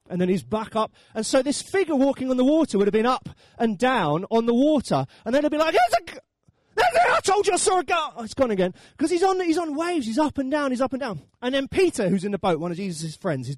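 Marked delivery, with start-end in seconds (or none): 5.8-8.1 high, excited mock panic; hammed-up frantic shout; panicked outcry. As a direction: cry out to your mates in alarm. Disciples panic glimpsing the figure.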